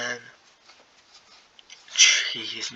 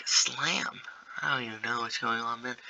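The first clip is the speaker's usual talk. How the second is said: normal talking voice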